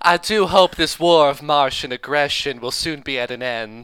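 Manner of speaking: loud mock Southern belle accent; hammed-up Southern belle. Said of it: "It's fucking hamming it up as shit".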